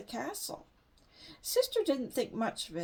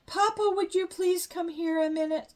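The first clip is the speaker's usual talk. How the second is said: higher, louder worried call to Papa; upset call; 6 semitones higher, 6 dB louder than usual. A narrator's reading. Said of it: "cried Mama, sounding upset".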